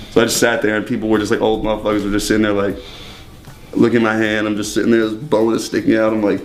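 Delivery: ordinary talk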